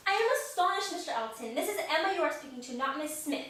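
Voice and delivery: light posh English accent, acted. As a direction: Emma rebuffing a suitor in a mild English accent. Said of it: "playing it in this accent".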